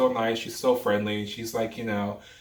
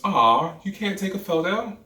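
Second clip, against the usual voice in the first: high, whiny letdown; mock a coworker pouting, pitched up; disappointed fan; 10 semitones higher, 4 dB louder than usual. Coworker let down by the celebrity, high pouting whine. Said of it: "and she goes".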